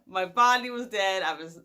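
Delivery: ordinary talk, nothing marked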